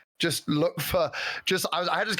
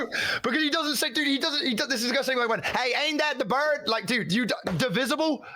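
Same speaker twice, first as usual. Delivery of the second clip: high, fast mock disbelief; 7 semitones higher than usual. Mocking friend's fancy word, high incredulous. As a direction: squeak out the incredulity.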